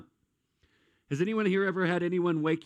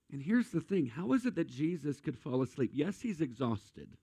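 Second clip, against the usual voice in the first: ordinary talk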